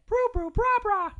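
put-on very high, loud baby babble; babbling baby. A narrator's reading. Baby Maggie copying her family, high loud babbling. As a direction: squeal out nonsense syllables like a baby. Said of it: "And Maggie said".

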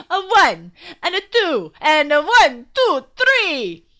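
loud, high band count-in; shouted count-in. Acted. Vlad at the organ, counting everyone in loudly. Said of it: "and shouted".

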